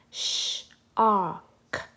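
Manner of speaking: "Sh-ark" split, with a long sh; stretched sh. Shown as a demonstration. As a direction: hold the sh, then "ark". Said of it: "Have a go at saying shark".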